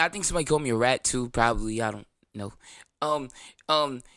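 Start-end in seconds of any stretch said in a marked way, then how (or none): none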